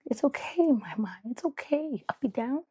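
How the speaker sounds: put-on high, sweet little-girl voice, consoling; lightly voiced toddler comforting mom; imitating her toddler. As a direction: soothe Mama like a two-year-old. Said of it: "she was like".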